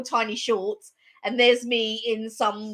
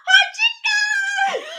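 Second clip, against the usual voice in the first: high, loud shout of triumph; excited shout; 6 dB louder, much slower than usual. Retold or imitated. Jumping up to claim the win, high thrilled shout. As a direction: yell the winning call, thrilled. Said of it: "and I'm like".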